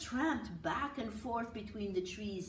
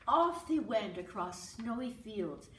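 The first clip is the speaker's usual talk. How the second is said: plain reading voice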